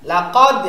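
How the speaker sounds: "লাকাদ" loud, with a hard bounced d; strong qalqalah push. Shown as a demonstration. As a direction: punch the end of "লাকাদ" loudly into an echo. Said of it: "একটু ধাক্কা জোরে দিতে হবে".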